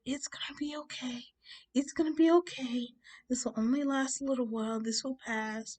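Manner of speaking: high-pitched soothing lady; calming. Retold or imitated. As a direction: imitate a woman reassuring someone upset. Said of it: "just kinda calm me down".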